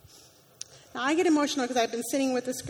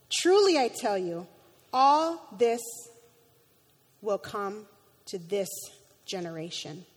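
usual speaking voice

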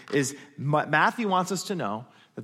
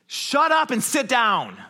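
slow, loud, forceful command; stern rebuke; 8 semitones higher, 5 dB louder, slower than usual. Acted. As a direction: rebuke the storm like a demon. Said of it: "That's what he's saying".

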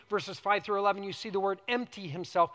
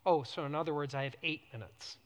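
tag-less dry reaction; 5 semitones lower, 4 dB quieter, faster than usual. Retold or imitated. Wry reaction to shrinking time.

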